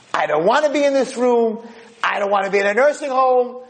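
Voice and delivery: put-on very high, ranting old man; screaming and yelling. Old man rants about the nursing home, screaming. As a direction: wail each complaint in a high yell. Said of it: "he's screaming and yelling".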